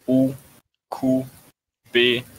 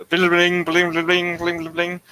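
high, rapid-fire "bling, bling"; imitated alert bling; 6 semitones higher than usual. Player alerting partner to a question, high imitated alarm. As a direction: chime like an alarm, pitched up.

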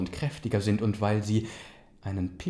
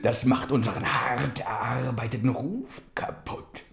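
lightly voiced grumbling uncle; 2 semitones higher, 6 dB louder than usual.

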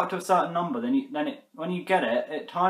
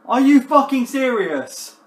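slower, louder outraged question; furious disbelief; 8 semitones higher, 7 dB louder, slower than usual. Real, not acted. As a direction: lean harder into the anger. Crash ruins high score.

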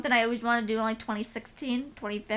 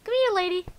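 much higher, louder call to the dog; pet talk; 9 semitones higher, 5 dB louder than usual. Real, not acted. Calling her dog.

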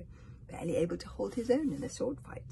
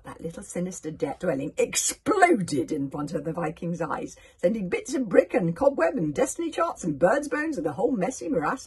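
loud, animated storytelling; excited narration; 2 semitones higher, 9 dB louder than usual. A narrator's reading. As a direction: build the explosion with big emphasis. Reader narrates a sudden explosion.

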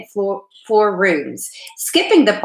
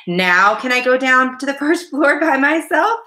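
high-pitched, climbing hopeful question; keen youngster begging permission; eager; 7 semitones higher, 3 dB louder than usual. A narrator's reading. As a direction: ask eagerly, pitch rising as you go. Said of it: "he asked eagerly".

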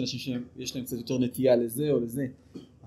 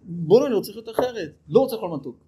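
higher and louder, eager resolve; eager inner voice; 6 semitones higher, 6 dB louder, faster than usual. Retold or imitated. Inner voice of a man suddenly deciding to change, eager and raised. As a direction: burst out wanting a new life. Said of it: "משהו בך אומר".

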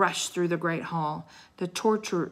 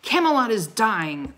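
loud, raised proclamation; booming; 5 semitones higher, 8 dB louder than usual. A narrator's reading. Knight warns the court of its doom, booming. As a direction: boom it out across the hall. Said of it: "the Christmas knight boomed".

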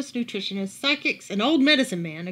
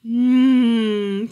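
yummy mmm; 8 dB louder than usual. A narrator's reading. Camilla tastes the beans.

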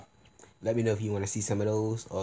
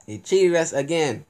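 louder, higher, stressing cheated; louder outrage; 6 semitones higher, 6 dB louder than usual. Real, not acted.

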